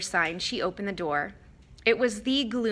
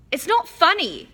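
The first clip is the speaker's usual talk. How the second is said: higher, louder fierce retort; 10 semitones higher, 7 dB louder than usual. A narrator's reading. Boy snaps at giggling friend, fierce and loud. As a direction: snap back hard at the giggling. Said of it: "said Ron fiercely".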